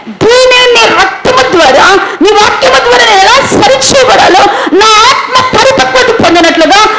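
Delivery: fervent prayer at full preaching force; impassioned confessing prayer. Retold or imitated. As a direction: pour out the confession, rising.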